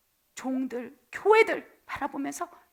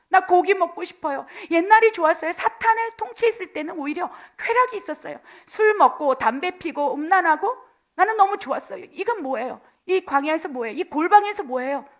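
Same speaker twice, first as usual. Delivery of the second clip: whiny grumbling, pitched up and louder; complaining; 5 semitones higher, 7 dB louder than usual. Acted. Israelites grumbling to God in the wilderness, whiny complaint. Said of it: "불평하고 불만하는 거예요".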